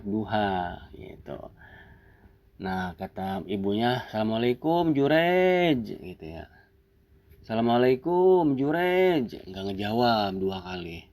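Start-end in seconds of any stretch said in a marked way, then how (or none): 4.1-5.8 higher, sing-out call on the name; mother calling out. Acted. Mother visiting her praying son, calling out to him. 7.5-9.4 higher, sing-out call on the name; mother calling out. Acted. Mother visiting her praying son, calling out to him. Mother calls a second time.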